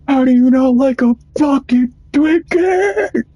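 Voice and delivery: mock the youtuber's shrill outrage at the kid; imitating Joe. A fan mimicking Joe Bartolozzi, outraged shriek. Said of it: "And Joe's like".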